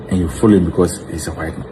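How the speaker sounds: ordinary talk, nothing marked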